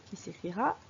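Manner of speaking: ordinary delivery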